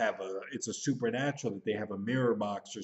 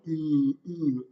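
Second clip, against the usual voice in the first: mock caveman grunt; 3 semitones higher, 5 dB louder than usual. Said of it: "They kinda just move around like".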